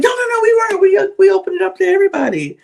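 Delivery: very high, fast, fussy mock protest; mock high denial. Vlogger mocking the Bambis' denial, high squeal. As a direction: squeal the denial like a flustered girl. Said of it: "they were like".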